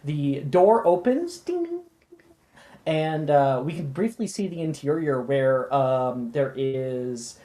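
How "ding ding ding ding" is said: high sung ding-ding; doorbell chime. Acted. Narrator voices the shop door bell, high and chiming.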